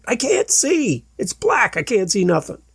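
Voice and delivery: high, frightened plea, falling back down; a frightened man begging to be understood; scared player telling the trainer he's blind; panicked. Retold or imitated. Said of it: "He's like".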